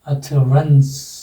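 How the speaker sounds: अथरंस drawn out very slowly, syllable by syllable; slow pronunciation demo. Shown as a demonstration. Teacher to class, slowly sounding out अथरंस. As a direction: spell out अथरंस slowly, each sound separate. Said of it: "अथर यहाँ पर लगेगा".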